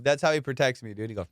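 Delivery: ordinary talk, nothing marked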